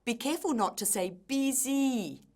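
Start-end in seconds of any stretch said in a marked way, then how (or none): 1.1-2.2 "busy" mispronounced with a long "ee", like "bee-zee"; long first vowel. Shown as a demonstration. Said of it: "That first vowel sound must be short".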